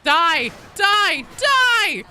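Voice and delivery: rising, loud repeated yell; battle shout. Real, not acted. Player attacking enemies in combat, escalating shouts.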